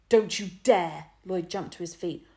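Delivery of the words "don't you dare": loud, slow threat; loud, deliberate furious warning. A narrator's reading. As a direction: warn her fiercely. Lloyd jumping up.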